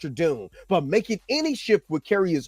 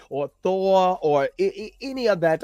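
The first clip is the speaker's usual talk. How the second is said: ordinary delivery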